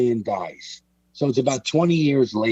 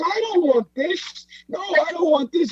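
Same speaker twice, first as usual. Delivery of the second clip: put-on old Pete refusing. Pete refusing the envelope, high insistent put-on voice. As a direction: refuse it like a scared old gangster.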